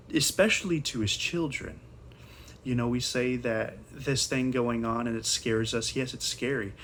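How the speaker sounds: normal talking voice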